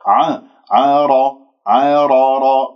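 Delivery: عار with the ر tapped, no lip rounding; rolled r. Shown as a demonstration. Teacher drills the tapped r for the class. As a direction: tap the ر of عار slowly. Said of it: "بدون أن أكور".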